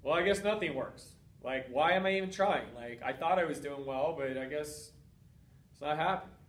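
low, soft and defeated, trailing off; depressed. Retold or imitated. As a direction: sink into hopeless resignation, voice dropping away. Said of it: "Or you go to depression".

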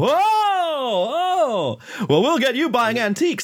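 high, drawn-out mock crowd chorus; imitated chorus. Roomful of antiques dealers answer in unison, high and drawn-out. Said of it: "they all say in unison".

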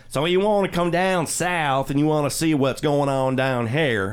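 exaggerated American South twang; Southern accent. Shown as a demonstration. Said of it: "I can't do the Boston accent, but I can do".

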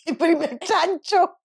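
high, giggly joke; blurted out through laughter; laughing. Real, not acted. Mother mishears podcast, laughs. (riéndose)

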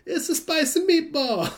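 hammed-up Italian accent, high and laughing; a jokey Italian mobster impression, laughing through it; mock Italian accent. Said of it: "Do I close it like an Italian?".

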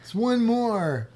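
high, loud laid-back burnout dude. Pretend. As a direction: plead like a lazy stoner. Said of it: "Like my burnout voice".

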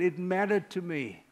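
ordinary talk

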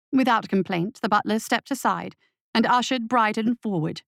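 ordinary delivery

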